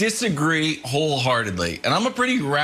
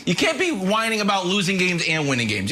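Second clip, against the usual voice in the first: ordinary delivery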